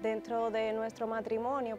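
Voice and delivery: usual speaking voice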